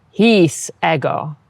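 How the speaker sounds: "His ego" slow, "ego" long-short-short; metrical Latin. Shown as a demonstration. Teacher modelling Latin meter, slow. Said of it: "pronounce the long vowels as long".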